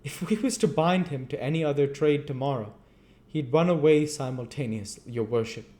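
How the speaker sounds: high, loud fawning beadle. A narrator's reading. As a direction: gush to the magistrate. Beadle voiced in story.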